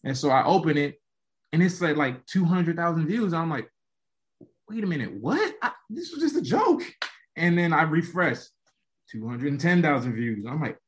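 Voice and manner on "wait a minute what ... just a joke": much higher, startled "wait a minute, what"; stunned disbelief. Retold or imitated. Seeing two hundred thousand views, a high stunned voice. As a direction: squeak it out in shock. Shocked at view count.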